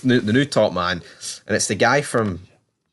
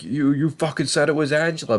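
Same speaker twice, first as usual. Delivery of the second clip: a higher, put-on voice for the accusing line; acted angry accusation; 6 semitones higher than usual. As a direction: throw the blame at him, pitched up. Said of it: "He's like".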